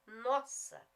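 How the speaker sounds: ordinary talk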